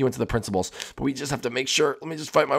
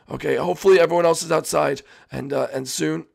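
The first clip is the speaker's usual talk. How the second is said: ordinary delivery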